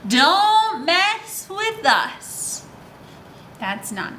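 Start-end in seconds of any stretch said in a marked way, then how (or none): 0.1-2.6 very slow, drawn-out taunt; tough little bullies. Acted. Teacher voicing the little guys warning off the bear, in a slow mock-tough drawl. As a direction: stretch every word like a swaggering bully. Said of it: "they're saying".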